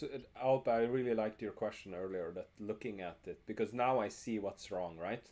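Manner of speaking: normal talking voice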